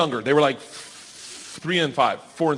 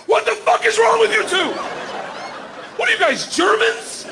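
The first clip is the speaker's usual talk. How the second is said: mock shout. Dad yelling at his daughters over the dog, exasperated shout. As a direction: bellow in disbelief.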